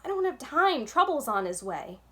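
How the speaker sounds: urgent. A narrator's reading. Jax warning Vic that trouble's on his way, urgent. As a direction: brush them off, pressed for time.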